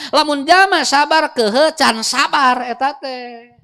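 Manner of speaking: high, loud, fervent sermon voice; preaching at full force. Acted. Preacher urging the congregation toward patience, loud and fervent. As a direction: preach it hard to the whole room.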